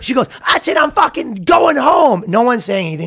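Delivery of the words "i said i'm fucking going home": high-pitched mock yell; retold yelling. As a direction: imitate an ex yelling in a tantrum. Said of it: "She's yelling and screaming".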